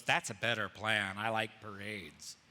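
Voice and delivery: a hint of smug, low self-satisfaction; lightly voiced vain prince, pitched low; egotistical Absalom. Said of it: "Absalom in his great ego goes".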